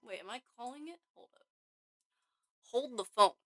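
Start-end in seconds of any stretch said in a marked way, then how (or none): none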